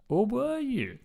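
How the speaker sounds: high-pitched teasing question; mock suspense. Co-host teasing a friend who says he was absent, mock suspense. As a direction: sow doubt in a mock-mysterious high voice.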